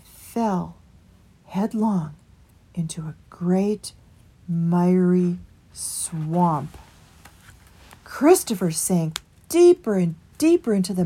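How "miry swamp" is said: ordinary delivery, nothing marked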